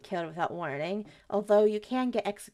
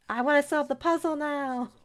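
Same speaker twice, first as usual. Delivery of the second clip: impatient whiny voice; 6 semitones higher, 4 dB louder than usual. Retold or imitated. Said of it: "I just hear, like".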